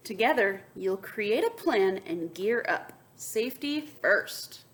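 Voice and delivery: bright read-aloud to the class; lively storybook voice; story narration. A narrator's reading. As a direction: read it like a picture book to kids. Reads picture book aloud.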